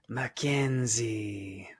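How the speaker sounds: slow, drawn-out vampire voice; Dracula voice. Acted. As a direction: drag the name out like a count. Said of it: "That's a great Dracula voice".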